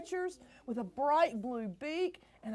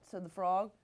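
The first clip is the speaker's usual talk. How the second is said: ordinary delivery, nothing marked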